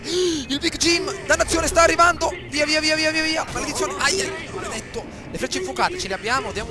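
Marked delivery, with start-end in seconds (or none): 0.0-5.0 loud, high urgent yells; frantic shouting. Real, not acted. Gamer fleeing Big Jim, frantic shouting. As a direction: shout in real panic as he charges.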